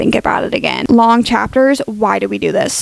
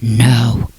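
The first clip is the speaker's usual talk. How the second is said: very low menacing growl of No; 13 semitones lower than usual. Pretend. Reader imagining the hero growling at heroine, deep growl. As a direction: drop deep and growl it at her. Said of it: "he's like".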